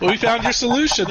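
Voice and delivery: high, loud mock phone robot; mocking singsong customer-service robot; automated robot lady. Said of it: "FedEx automated robot lady".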